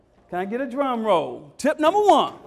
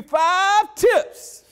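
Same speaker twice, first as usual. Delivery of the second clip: announcing higher and louder than meant; too loud; 8 semitones higher, 6 dB louder than usual. Shown as a demonstration. Speaker announcing tips to parents, too loud. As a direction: boom it out before you catch yourself. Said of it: "Oops, I don't want".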